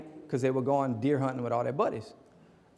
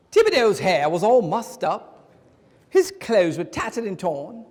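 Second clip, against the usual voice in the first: mock British accent telling a Cajun joke; 3 semitones higher, 3 dB louder than usual. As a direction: play an Englishman attempting a Cajun joke. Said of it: "How was my British accent?".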